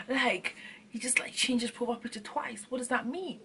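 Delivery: conspiratorial whisper about his profile picture; hushed whisper. Acted. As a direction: whisper it like a secret. Gossiping about a boyfriend's profile.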